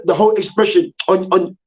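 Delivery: ordinary talk, nothing marked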